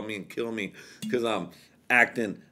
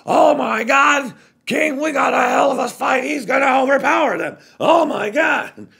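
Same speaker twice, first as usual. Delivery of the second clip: much higher, louder announcer shout; wrestling commentator; 9 dB louder than usual. Retold or imitated. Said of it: "J R would have been like".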